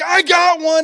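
very high, very loud mock kid's yell; excited scream. Carter announcing his catch amid chaos, excited scream. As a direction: scream it like a thrilled little boy.

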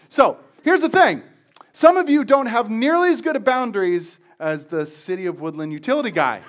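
loud, high, emphatic point; preaching at full force. Real, not acted. Preacher to congregation about boundaries, loud emphatic.

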